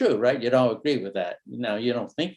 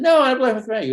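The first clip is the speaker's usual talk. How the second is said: put-on a child protesting, much higher and louder; whiny kid; 11 semitones higher, 8 dB louder, much faster than usual. Son protesting his mother's rule, high and loud. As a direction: play the kid pleading to go out. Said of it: "And you said".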